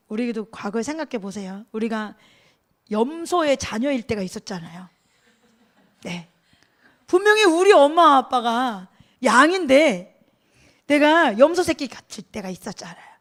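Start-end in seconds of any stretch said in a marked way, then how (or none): none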